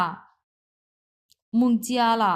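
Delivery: 蒙加拉 very slow, each syllable's tone drawn out; careful tonal demo. Shown as a demonstration. Teacher slowly sounding out 蒙加拉 for students. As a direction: spell out 蒙加拉 syllable by syllable in tones. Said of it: "এইভাবে উচ্চারণ করি".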